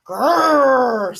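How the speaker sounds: loud growling grrr; lion growl. Acted. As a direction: growl like a cranky young lion. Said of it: "said the lion".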